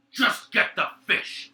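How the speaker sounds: a loud, slow, high yell; shouting order. Acted. Guard yelling at his comrade to fetch fish. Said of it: "Borngray gives them everything".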